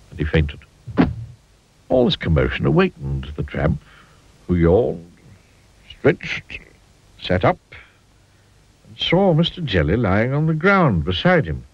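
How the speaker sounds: plain reading voice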